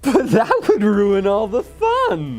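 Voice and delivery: high, slow laughing delivery; laughing Joker. Shown as a demonstration. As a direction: giggle through the threat. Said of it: "The real Joker would deliver the line like".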